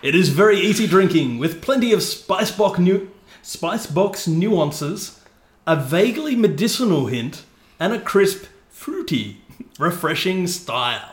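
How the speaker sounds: drawn-out, showy radio blurb; tosser radio voice. Pretend. Host finishing the beer blurb, mock radio voice. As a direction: relish each tasting note like a pompous presenter. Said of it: "talking like a tosser".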